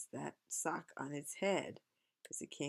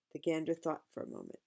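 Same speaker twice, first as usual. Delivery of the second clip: ordinary delivery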